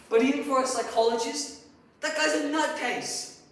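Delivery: loud, high verdict of disbelief; exasperated. Acted. Psychologist denouncing his former colleague, exasperated. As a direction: let the last words ring out loud.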